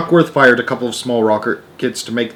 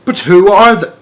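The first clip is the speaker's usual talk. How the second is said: loud, higher exasperated cry; 7 semitones higher, 7 dB louder than usual. Acted. Shanks to ground control, crying out. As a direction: cry out demanding answers. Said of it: "cried Shanks".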